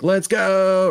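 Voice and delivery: acting out a kid thrilled by a new game; excited gamer kid. Kid cheering at getting a new Mario game, excited. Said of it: "and you're like".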